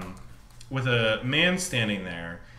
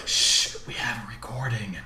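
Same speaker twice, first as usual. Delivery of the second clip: hushed unvoiced whisper; 4 semitones lower, 3 dB quieter than usual. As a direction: whisper it like a shushing guide. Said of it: "And you're like".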